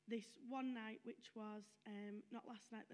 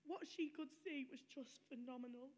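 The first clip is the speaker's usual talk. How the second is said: ordinary talk, nothing marked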